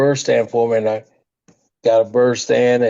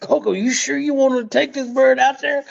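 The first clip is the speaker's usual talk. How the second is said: hammed-up worried buddy, much higher; mimicking a nervous Pat; 11 semitones higher than usual. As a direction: voice pitched way up, fretting and pleading. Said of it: "'cause Pat was just going".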